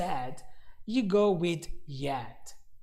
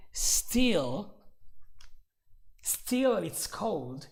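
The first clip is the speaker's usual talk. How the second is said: drawn-out "Still, still it's cold" slowly; stress on still; much slower than usual. Shown as a demonstration. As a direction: stretch both "Still"s to make your point. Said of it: "then you can say".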